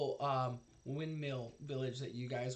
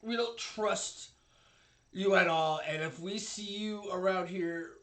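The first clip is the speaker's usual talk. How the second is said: raised, forceful guard's warning; stern guard warning; 4 semitones higher, 7 dB louder than usual. Acted. Guard tells the party they have no business here, stern warning. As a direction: warn them off with some force.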